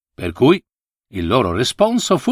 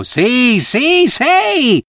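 much higher, louder eager squeal; shrieking monkey; 11 semitones higher, 3 dB louder, much slower than usual. A narrator's reading. The monkey squeals yes at the monk. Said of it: "strillò la scimmia".

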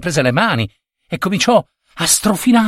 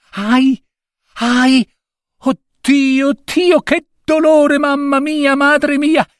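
very high wailing in pain; girl crying out in sudden agony; 14 semitones higher, slower than usual. A narrator's reading. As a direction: wail it high, voice way up. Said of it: "gridò la ragazza".

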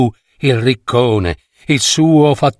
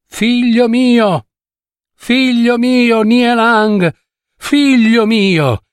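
much higher, slow, drawn-out cries; calling out endlessly; 9 semitones higher, slower than usual. Acted. Mother Nie on the rock calling her lost son, drawn-out cries. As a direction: cry his name across the water. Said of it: "a gridare ininterrottamente".

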